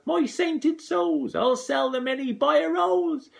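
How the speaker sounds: high, loud slow shriek; mother yelling over gold leaves. A narrator's reading. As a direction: screech with greed. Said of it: "yells out loud".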